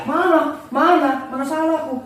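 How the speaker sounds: suara tinggi anak yang menyangkal; anak mengelak. Retold or imitated. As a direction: mengelak dengan nada tinggi, pelan. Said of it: "katanya mengaku benar". (anak tidak mengaku salah, nada tinggi menyangkal)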